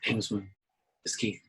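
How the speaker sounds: a soft, polite check-in; quiet phone greeting. Retold or imitated. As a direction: call in a low-key voice. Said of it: "you know".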